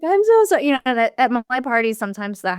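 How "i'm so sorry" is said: high, loud apologetic squeal; hammed-up sorry guest; mock embarrassed guest. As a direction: play the mortified guest.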